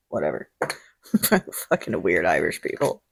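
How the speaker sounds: a low, quiet, grumpy aside; mock grumble. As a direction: grumble it under your breath. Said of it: "mock grumbling low".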